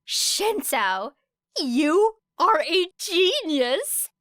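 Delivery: loud, high, slow exclaim; delighted Ashido. A narrator's reading. Ashido praising Shinso's braiding idea, loud and delighted. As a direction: gush over the idea.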